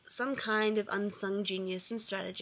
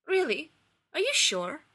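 surprised; 7 semitones higher, 4 dB louder than usual. A narrator's reading. Radek stunned surprise.

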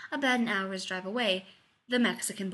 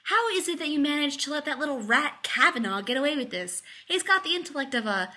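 loud, higher-pitched indignation; fed-up, raised-voice complaint; indignant rant; 7 semitones higher, 5 dB louder than usual. Acted. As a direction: rant in exasperation at a lawyer. Rodney rants at the lawyer.

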